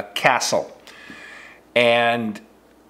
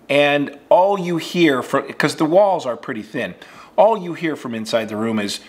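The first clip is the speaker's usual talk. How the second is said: ordinary talk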